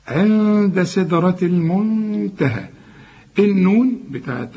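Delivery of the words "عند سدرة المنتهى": عند and المنتهى with long hummed n; hidden noon, ghunna. Shown as a demonstration. Teacher recites the verse as a model, hidden noon with ghunna. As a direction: slowly hum the n in عند and المنتهى. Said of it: "اخفيها وغنها".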